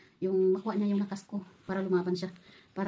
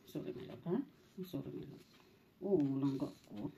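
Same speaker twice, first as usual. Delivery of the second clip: very soft, low murmur; near-whispered comfort; hushed soothing; 8 semitones lower, 12 dB quieter than usual. Real, not acted.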